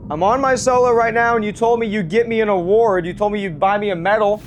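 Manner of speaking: ordinary talk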